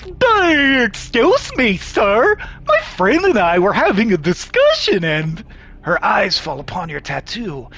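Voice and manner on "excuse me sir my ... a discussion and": high voice with drawn-out opening; high-pitched, dainty lady addressing a stranger; petite woman. Acted. Petite woman politely interrupts a stranger, high dainty voice.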